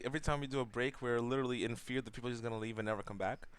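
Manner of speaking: ordinary talk, nothing marked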